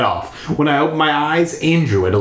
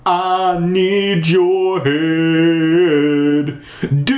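slow drawn out ghost croon; spooky singsong ghost; 5 semitones higher, much slower than usual. Acted. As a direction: sing it slowly like a ghost. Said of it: "he said softly".

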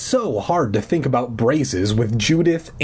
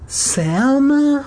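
faint voice; 4 dB louder than usual. Retold or imitated. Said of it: "Again, the faint voice, nearly a whisper".

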